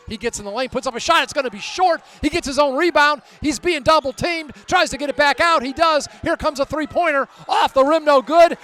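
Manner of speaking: loud, high-pitched live game call; urgent basketball commentary riding every bounce; excited play-by-play. Real, not acted. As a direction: call the scramble at full voice, building to the rebound.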